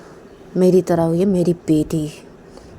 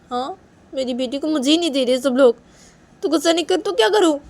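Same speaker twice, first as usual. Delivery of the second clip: higher, annoyed grumbling as the upset mother; Nisha's angry complaint; 8 semitones higher, 4 dB quieter than usual. Acted. Nisha complains to Manisha, annoyed and higher-pitched. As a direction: sulk and snap that nobody hands back the baby.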